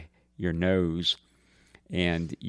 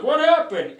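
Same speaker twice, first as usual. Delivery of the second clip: loud, high-pitched demand; imitated alarmed dad; 7 dB louder than usual. Brother imitates Dad seeing the bloody nose, alarmed. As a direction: burst in as the alarmed father. Acting out Dad's alarmed question.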